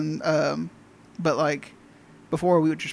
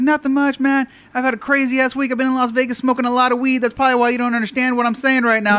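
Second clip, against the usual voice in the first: high, loud acquaintance voice; chatty stoned caller; 8 semitones higher, 5 dB louder than usual. Retold or imitated. Weed-smoking caller to pharmacy worker, chummy high-pitched chatter. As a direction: rattle on as the over-familiar caller.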